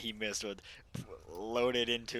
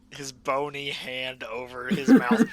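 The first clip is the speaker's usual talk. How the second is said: ordinary delivery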